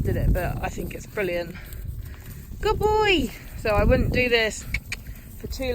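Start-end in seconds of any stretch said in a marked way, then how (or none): 2.6-3.3 high, bright call of praise; praising horse. Real, not acted. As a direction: cheer the horse on.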